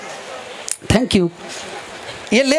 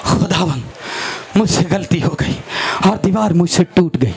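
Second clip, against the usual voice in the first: low, subdued penitent confession, acted; mock night-time prayer; 7 semitones lower than usual. Wrongdoer confessing to God in night prayer, low mock-penitent voice. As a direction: imitate someone humbly praying in a low voice. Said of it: "ਉਹ ਰਾਤੀ ਦੁਆ 'ਚ ਬਹਿ ਜਾਂਦੇ ਨੇ".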